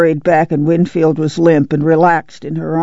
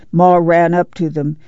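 ordinary delivery

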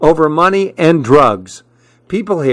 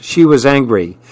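ordinary delivery, nothing marked

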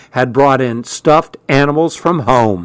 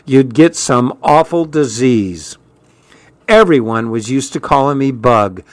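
usual speaking voice

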